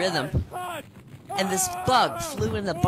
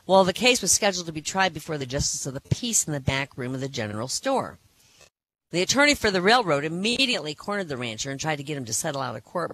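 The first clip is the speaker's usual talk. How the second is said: normal talking voice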